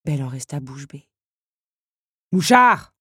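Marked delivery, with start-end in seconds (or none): 2.3-2.9 loud, high bark at a servant. A narrator's reading. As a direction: bark the name as an order. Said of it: "aboya la comtesse". (aboiement impérieux)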